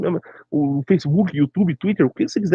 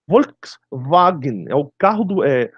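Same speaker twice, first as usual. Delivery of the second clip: normal talking voice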